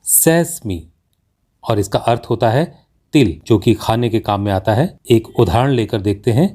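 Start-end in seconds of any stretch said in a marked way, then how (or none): 0.0-0.9 सेसमी said as "SES-mee"; correct pronunciation. Shown as a demonstration. Teacher gives learners the correct word, carefully pronounced. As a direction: say सेसमी with short first e, long final ee. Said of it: "इस शब्द का सही उच्चारण होता है".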